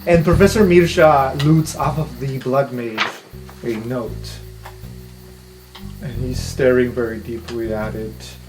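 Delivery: ordinary talk, nothing marked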